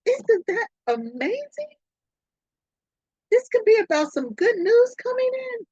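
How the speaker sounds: high, bright-eyed excitement over the card; very excited. Real, not acted. Reader thrilled by the card, excited and high. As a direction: gush about the good news. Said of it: "I'm very excited".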